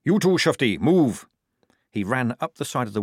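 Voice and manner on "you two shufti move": raised, louder sergeant's command; barked order. A narrator's reading. Sergeant hurrying two recruits away, barked order. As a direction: bark it at the recruits.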